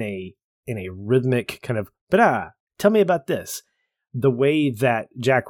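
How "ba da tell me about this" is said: mock flourish. Kaffee grabbing the book.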